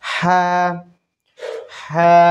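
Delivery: হা breathed thin from the throat; a thin, airy হা. Shown as a demonstration. Teacher demonstrating the thin হা for students. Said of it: "এটা হচ্ছে চিকন হা".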